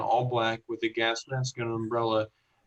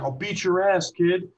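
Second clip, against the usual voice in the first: a mock threat, pitched higher and pushed; threatening retold cop; 6 semitones higher, 5 dB louder than usual. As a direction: menace the kid, half acting it out.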